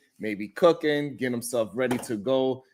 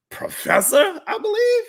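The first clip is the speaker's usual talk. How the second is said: mock outrage; 5 dB louder, slower than usual. Real, not acted.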